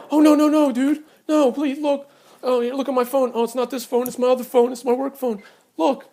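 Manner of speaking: high, loud frantic protest; imitating a flustered guy backpedaling; panicked pleading neighbor. Neighbor pleads when confronted at door.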